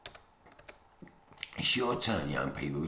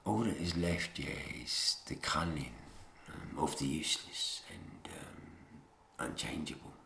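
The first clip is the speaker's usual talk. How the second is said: whisper; 5 semitones lower, 8 dB quieter than usual. Real, not acted. Whispered about the culling.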